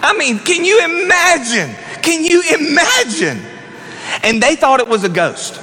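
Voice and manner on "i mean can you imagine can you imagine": amazed. Real, not acted. Preacher marveling at Jesus strolling past them.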